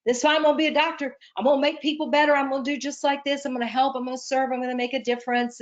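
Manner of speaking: quick, high, bursting with youthful zeal; fast starry-eyed vow that fades out by the end; eager excitement. Retold or imitated. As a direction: rattle it off like an eager young idealist. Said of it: "I'm like".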